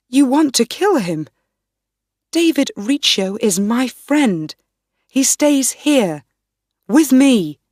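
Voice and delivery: loud, high, fast at first, loudest at the end; defiant queen shouting. A narrator's reading. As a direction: stand your ground and raise the voice. Queen defends Riccio against Ruthven.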